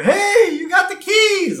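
high, loud mock old-man holler; excited shout. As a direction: yell it out high and drawn out. Grandpa shouting at others in retold scene.

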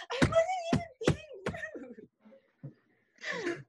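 very high, fast mock whine; exasperated protest. Child defending herself to mom, hammed-up exasperation. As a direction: squeal the protest out in a rush.

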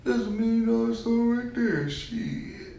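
high, loud mimicry with a drawn-out end; mock quote. Co-host mocking a botched soundbite, high mock quote. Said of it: "You didn't even say that".

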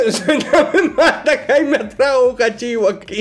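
high-pitched quote through laughter; slow, high, laughing echo; laughing mimicry. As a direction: repeat it mockingly, cracking up. Cohost mocks story quote while laughing.

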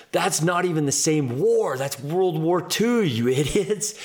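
high-pitched, exasperated retold rant; outraged coach. Coach correcting trainees on the war, retold outrage. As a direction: imitate a coach berating his squad, stretching key words.

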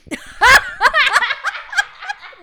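high, loud laugh thrown back. Real, not acted. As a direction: laugh with your head back.

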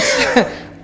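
short high burst of laughter; sudden real chuckle. Real, not acted. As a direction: laugh out in the middle of the confession. Laughs while admitting the theft.

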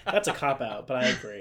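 amused reply through chuckles; laughing agreement. Real, not acted. Amused by co-host's joke answer.

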